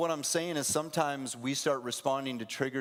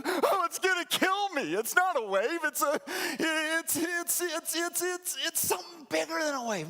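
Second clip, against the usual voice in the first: panicked fisherman; 13 semitones higher than usual. Pretend. Panicked fisherman, ghost or wave.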